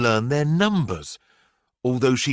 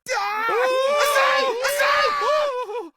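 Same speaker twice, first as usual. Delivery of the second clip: put-on children screaming; slower than usual. A narrator's reading. Frightened schoolchildren shrieking at the python in the playground. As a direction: scream it like kids seeing a snake. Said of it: "the other children screamed".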